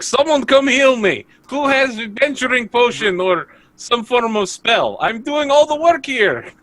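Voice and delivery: hammed-up demand for healing; whiny cry for help. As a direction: wail for a healer like a martyr. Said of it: "I'm just gonna be like".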